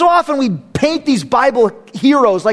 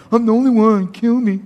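mock whine. Crying and whining in a cave.

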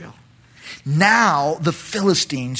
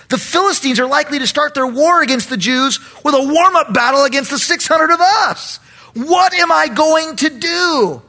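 anguished; 10 semitones higher, 4 dB louder than usual. Acted. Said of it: "No doubt thinking".

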